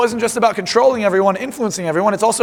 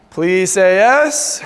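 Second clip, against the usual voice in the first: slow, drawn-out request; much slower than usual. Shown as a demonstration. Speaker asks the whole room again, slow and rising. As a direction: spell it out slowly so every hand goes up. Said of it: "so one more time".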